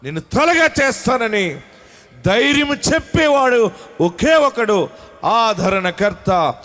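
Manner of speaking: high, loud, slow preaching cry; full-force preaching. Acted. Pastor proclaiming comfort to his congregation, full-force preaching. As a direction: proclaim it over the church.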